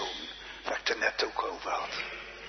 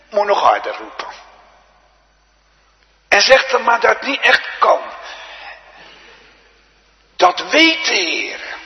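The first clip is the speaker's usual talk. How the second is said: loud, high, urgent pulpit cry; full-force preaching; 8 semitones higher, 10 dB louder than usual. Real, not acted. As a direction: call out to the congregation phrase by phrase. (luid en dringend preken)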